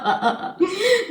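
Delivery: a quick laugh rising at her own joke. Real, not acted. Laughing at her own joke about debt to viewers, rising laugh. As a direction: laugh it off, going up.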